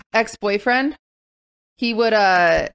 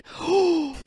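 sudden gasp; 7 semitones higher, 4 dB quieter than usual. Real, not acted. Suddenly remembers something.

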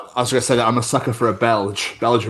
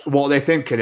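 normal talking voice

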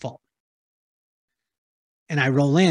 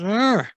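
disgusted ugh; 6 semitones higher than usual. Retold or imitated. Feeling scruffy in dog hair.

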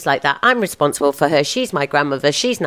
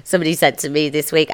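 ordinary talk

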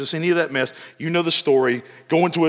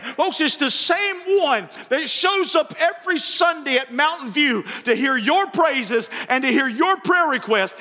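higher, fervent sermon voice, driving it home; preaching at full force; 9 semitones higher than usual. Acted. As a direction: preach it to the whole church.

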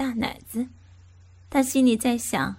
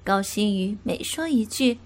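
plain reading voice

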